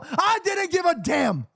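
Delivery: defiant shout. Real, not acted. Recalls crying on camera, shouts.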